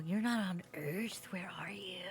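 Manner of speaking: soft unvoiced whisper. Real, not acted. Streamer whispering a reaction to the game text. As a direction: breathe it, barely voiced.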